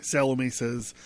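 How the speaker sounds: usual speaking voice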